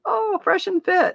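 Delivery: high, slow, mocking girl voice; a mocking high-pitched imitation; mock high girly voice. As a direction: squeak it out like a teen girl. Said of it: "she was like".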